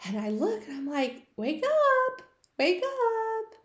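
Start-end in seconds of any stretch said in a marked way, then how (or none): 1.3-3.5 put-on high excited child's call; calling a pet to wake up. Child on Christmas morning calls to her bunny, high and bright.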